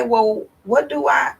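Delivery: ordinary talk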